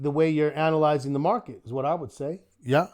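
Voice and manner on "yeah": ordinary talk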